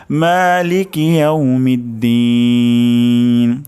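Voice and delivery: মালিকি ইয়াউমিদ্দিন slow, দিন held long; tajweed recitation. Shown as a demonstration. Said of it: "তাহলে". (ধীরে তাজবীদসহ মালিকি ইয়াউমিদ্দিন)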